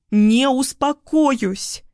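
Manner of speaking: high, drawn-out angry cry; hysterical refusal. A narrator's reading. Hysterical woman refuses to calm down. (истерично, высоко и протяжно)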